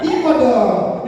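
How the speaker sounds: put-on drawn-out, high regional-accent exclamation; regional Indonesian accent. Coach mocking local dialect on stage, regional accent. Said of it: "membawa logat daerah kita".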